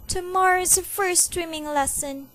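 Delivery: put-on child complaining about swimming lessons. A narrator's reading. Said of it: "Sydney complained".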